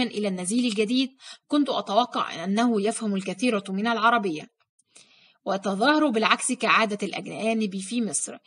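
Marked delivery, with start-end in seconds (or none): none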